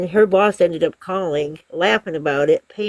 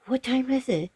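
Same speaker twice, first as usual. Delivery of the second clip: higher, softer imitation of a startled customer; lightly voiced surprised customer; 7 semitones higher, 6 dB quieter than usual. Customer asks the pizza caller the time, mild surprise. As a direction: lightly mimic a caller caught off guard.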